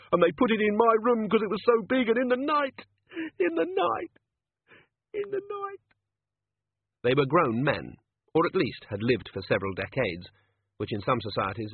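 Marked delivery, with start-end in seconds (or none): 0.1-5.9 rising terror. Acted. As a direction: voice climbing higher, then fading. Rising dread, repeated phrase.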